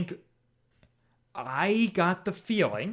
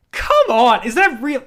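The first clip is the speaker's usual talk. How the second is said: loud, high cry of disbelief; exasperated outburst; 9 semitones higher, 10 dB louder than usual. Real, not acted. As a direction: burst out in exasperation, half laughing. Exasperated at solving it again.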